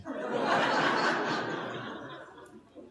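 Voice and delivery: short breathy laugh at his own goof; laughs at himself. Real, not acted. Pastor laughs at his funeral slip, breathy laughter. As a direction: laugh it off, embarrassed.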